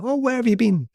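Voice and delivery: high, airy mock wife voice; wife's breezy greeting. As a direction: play the oblivious wife, light and high. Wife greets him unaware of injury.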